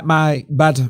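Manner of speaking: ordinary talk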